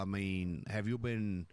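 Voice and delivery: ordinary talk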